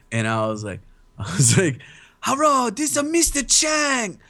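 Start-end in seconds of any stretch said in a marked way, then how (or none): 2.2-4.2 high-pitched, hammed-up mock Chinese accent; Mister Chang. Prank-calling a friend as a character, mock Chinese accent. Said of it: "I really love doing Mister Chang".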